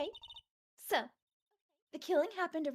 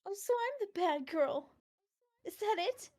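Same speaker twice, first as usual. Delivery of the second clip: sassy; 6 semitones higher, 5 dB louder than usual. Acted. April May character sassy retort.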